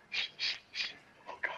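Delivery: shushing whisper. Real, not acted. Hiding from the hunter.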